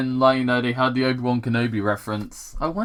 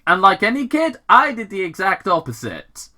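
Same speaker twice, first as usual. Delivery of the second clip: put-on young Sonic; 7 semitones higher, 5 dB louder than usual.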